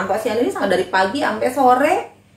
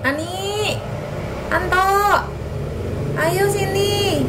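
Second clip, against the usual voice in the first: higher, drawn-out call; 11 semitones higher, slower than usual. Acted. Kakak calls Ani and Anto to come, calling out. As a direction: call the kids over with long vowels.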